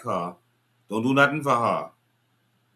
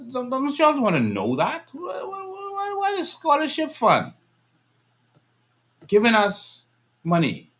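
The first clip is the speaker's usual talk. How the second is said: incredulous outcry; 12 semitones higher than usual. Real, not acted. Outraged questioning of scholarship fund.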